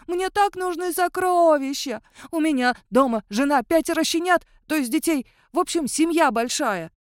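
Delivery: high, loud whining werewolf plea; plaintive wailing. A narrator's reading. Werewolf begs for treasure for his family, plaintive wail. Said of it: "жалобно завыл он".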